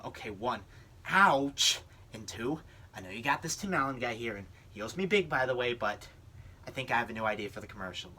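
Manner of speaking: ordinary delivery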